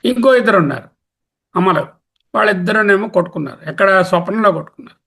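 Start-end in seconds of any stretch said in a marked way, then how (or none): none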